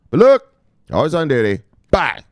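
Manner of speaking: high, loud farewell; saying goodbye to viewers, high and loud; loud sign-off. Real, not acted. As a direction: sign off with a loud, playful bye. Signature sign-off.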